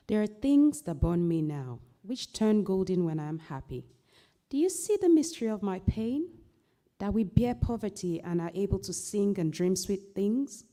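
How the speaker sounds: poem recital. Acted. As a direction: read it as a solemn elegy that swells. Poem reading.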